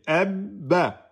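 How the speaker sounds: Ebba with the bb held before the a; held double b. Shown as a demonstration. Teacher explaining shadda, bb held. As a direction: hold the b, then release the a in Ebba. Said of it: "On garde la lettre avant de prononcer".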